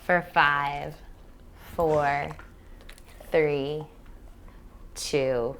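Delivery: slow, drawn-out breath count; guided meditation. Real, not acted. Teacher counting breaths for human and dog to relax, slow meditation voice. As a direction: count them gently through each breath, with long pauses.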